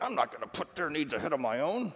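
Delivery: acting out a selfish refusal; mock objection. Preacher voicing a reluctant spouse, mock indignant refusal. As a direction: voice a put-upon spouse digging in.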